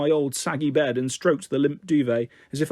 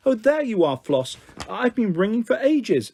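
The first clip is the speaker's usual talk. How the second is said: higher, lively greeting with mock exasperation; pitched-up, emphatic motherly chatter; animated mum on the phone; 7 semitones higher, 3 dB louder than usual. A narrator's reading. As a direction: chide them fondly down the phone.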